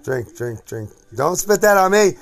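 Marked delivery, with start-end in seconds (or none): 1.2-2.1 raised, higher, louder warning; alarmed protest. Real, not acted. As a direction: call out in sudden protest. Reacts to being spat at.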